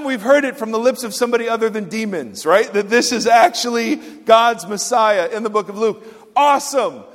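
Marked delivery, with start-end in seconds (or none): none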